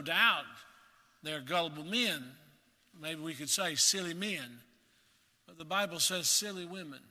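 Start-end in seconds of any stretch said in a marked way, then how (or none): none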